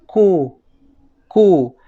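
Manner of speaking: Q said as "ku", k with rounded lips; isolated Q, voiceless k gliding into u; KW sound demonstrated. Shown as a demonstration. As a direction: sound out Q slowly as a labialized k. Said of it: "La K W suena como".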